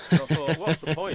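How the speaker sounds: laughing through a self-quote. Retold or imitated. Laughing self-quote about spelling.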